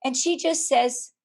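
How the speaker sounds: normal talking voice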